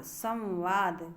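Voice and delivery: संवाद slow with a clear म; careful pronunciation. Shown as a demonstration. Teacher repeats the word for students, slowly. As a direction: draw out संवाद, सम then वाद. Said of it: "वही चीज़ है संवाद".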